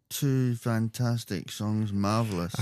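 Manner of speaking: low, slow, soft mock praise; mimicking the host's praise. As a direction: quote it back slowly, quietly and unimpressed. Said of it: "You just said".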